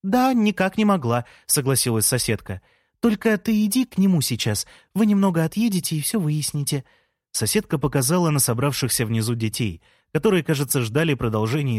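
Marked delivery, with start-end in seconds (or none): 0.0-6.8 neighbour's voice. A narrator's reading. Neighbour agreeing.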